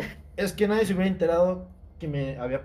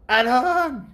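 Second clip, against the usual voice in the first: mock yell of the name, high and loud; mom's yell; 9 semitones higher, 5 dB louder than usual. Son retelling how mom yelled his name mid-fight, high mock yell. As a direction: call him out like mom from the balcony. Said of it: "así no grita mi mamá".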